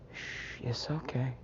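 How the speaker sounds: soft shush, then hushed reassurance; very soft, comforting murmur; soothing hush. Acted. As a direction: hush them gently to sleep. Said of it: "rocked him to sleep".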